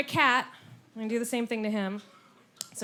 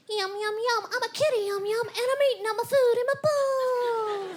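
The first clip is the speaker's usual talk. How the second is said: much higher, singsong kitty voice; the cat coos it back at you; cat talking; 12 semitones higher, 4 dB louder than usual. Retold or imitated. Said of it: "if he actually turned ... and said".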